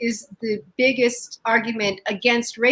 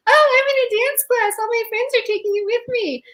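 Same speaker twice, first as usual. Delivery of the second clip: high-pitched imitation of excited friends; mock gushing; 13 semitones higher than usual. Comic mimicking carefree empty-nester friends, high gushing voice. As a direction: gush it high, sending them up.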